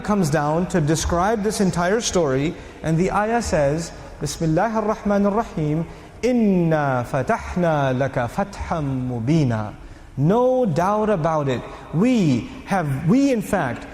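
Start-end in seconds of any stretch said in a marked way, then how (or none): 4.2-9.7 Quran recitation. Acted. Recites the ayah.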